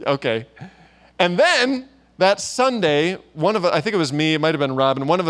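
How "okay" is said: lower, lightly scoffing okay; mock dismissal, not taking it seriously; dismissive chuckle-off. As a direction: imitate brushing it off with a pfft. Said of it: "kind of chuckles it off".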